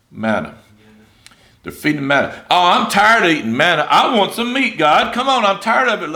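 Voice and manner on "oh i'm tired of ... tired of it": loud, higher-pitched mock whine; whining. Israelites complaining to God about manna, whining. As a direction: whine and complain like fed-up people. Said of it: "so they start whining and whining".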